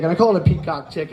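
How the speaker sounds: ordinary talk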